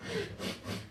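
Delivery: high, soft comic sob; mock sobbing. Arrested man asked about his tattoo, high mock sobbing. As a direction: whimper in mock tears. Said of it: "And boy, was I a crier".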